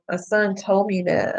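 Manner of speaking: ordinary delivery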